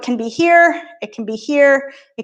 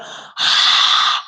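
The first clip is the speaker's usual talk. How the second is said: throaty breath out through the nose, like fogging a mirror; ujjayi exhale sigh. Shown as a demonstration. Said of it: "sounds like you're sighing as you breathe out".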